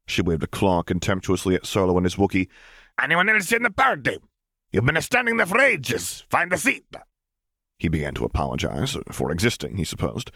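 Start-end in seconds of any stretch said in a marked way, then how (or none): 3.0-6.9 high squeaky alien waitress; impatient waitress. Acted. Tiny waitress nagging at the door, high and squeaky. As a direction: hurry them along, tiny and bossy.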